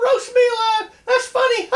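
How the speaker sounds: high, loud words through laughter; laughing shout. Acted. Rabbit laughing off roasting threat.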